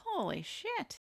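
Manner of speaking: high, soft exclamation; high-pitched astonishment; mock shocked reaction. Acting out reaction to backflop.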